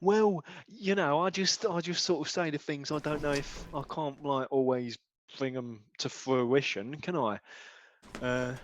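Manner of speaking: quiet, deflated and unsure; sheepish mumble. Acted. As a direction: mumble it, caught out. DJ deflated after challenge.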